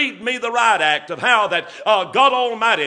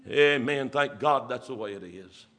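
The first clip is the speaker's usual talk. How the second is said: ordinary delivery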